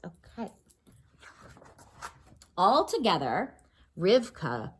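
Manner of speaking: ordinary delivery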